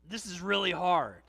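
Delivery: a burdened prayer that says it's hard; weary groan to God. Pretend. Believer groaning honestly to God in a weary plea. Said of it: "A groan says to God".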